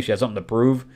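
ordinary talk, nothing marked

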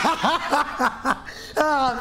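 high yelled laugh. Real, not acted. Streamer cracking up after screaming, laughing yell.

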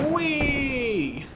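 high, long cry sliding downward; playful whee. Pretend. Playful cry while dropping down.

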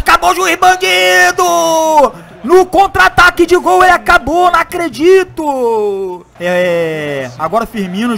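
lively football match commentary; play-by-play commentary. Acted. Live play-by-play of own match.

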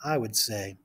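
put-on reading to children. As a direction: read it to a class of small kids. Reads picture book aloud.

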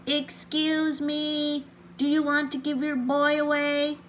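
mild storybook shout, very polite. A narrator's reading. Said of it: "shouted at the top of his lungs, but polite as he could be".

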